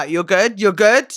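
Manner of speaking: ordinary delivery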